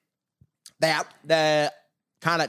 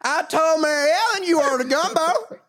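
mock Louisiana cook; 10 semitones higher than usual. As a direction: play the chatty cook from down the bayou.

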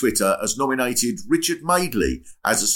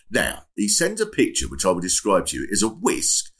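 ordinary delivery

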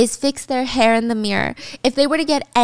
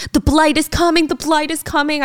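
higher-pitched mock alarm; urgent warning; 5 semitones higher than usual. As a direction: warn her urgently, voice lifted. Urgent warning to leave danger.

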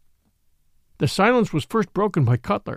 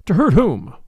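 sharp, louder snap on hurt; sharply; 6 dB louder than usual. A narrator's reading. Captain challenging the priest, sharp and louder. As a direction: bite the question off hard. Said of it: "and Cutler said sharply".

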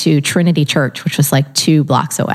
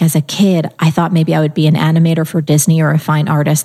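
normal talking voice

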